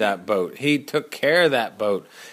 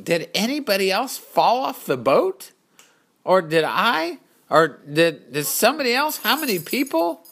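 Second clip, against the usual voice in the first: rising, anxious questioning voice, climbing higher; worried thoughts; 7 semitones higher than usual. Acted. Courage wonders alone in the water, anxious. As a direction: panic creeping up as he wonders who fell.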